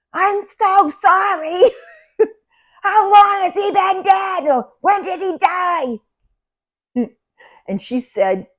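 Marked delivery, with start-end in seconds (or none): 0.1-6.0 very high, drawn-out shouted condolence; loud, high, stretched-out holler; yelling into her ear. Retold or imitated. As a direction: shout each word slowly for deaf ears. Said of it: "I'm yelling into her ear".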